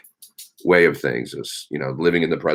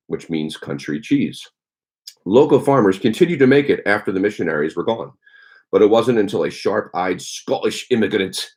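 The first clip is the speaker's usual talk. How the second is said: higher, livelier read-aloud voice; 3 semitones higher than usual. Acted. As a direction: read the trivia like a showy presenter. Reads cheese history from book.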